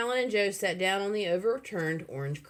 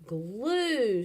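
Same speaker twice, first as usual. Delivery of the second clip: screaming; 6 semitones higher, 3 dB louder than usual. A narrator's reading. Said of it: "screamed Billy".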